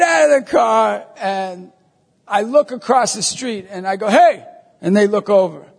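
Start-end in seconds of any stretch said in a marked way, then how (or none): 4.1-4.4 calling out. Retold or imitated. Priest hailing two strangers across the street, calling out.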